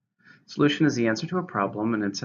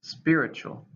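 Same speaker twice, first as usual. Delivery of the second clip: "Spiritual" said SPI-ri-tual, stress on SPI; stress on first syllable; 5 semitones higher, slower than usual. Shown as a demonstration. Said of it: "stress on the first".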